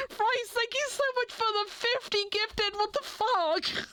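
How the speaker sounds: real excited thanks; overwhelmed thanks. Real, not acted. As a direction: gush at the generous gifter. Thanking for big gift.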